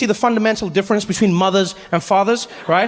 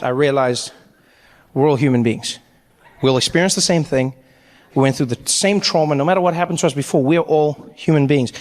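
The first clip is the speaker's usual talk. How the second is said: mock profound; 6 semitones lower than usual.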